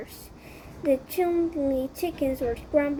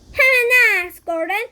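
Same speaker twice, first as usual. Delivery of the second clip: louder, higher pep talk; loud encouragement; 7 semitones higher, 7 dB louder than usual. Acted. Benny cheering up Golden, loud high pep talk. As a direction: fire up your friend.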